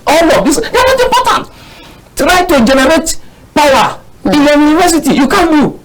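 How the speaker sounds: indignant outburst. Real, not acted. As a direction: tear into the professors, loud and high. Indignant rant at professors.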